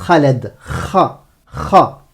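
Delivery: "Khaled" then "Kha", throaty rasp on each onset; guttural kh demo. Shown as a demonstration. Teacher drills the syllable from the name, "Kha" with rasped kh. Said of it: "Comme dans Khaled".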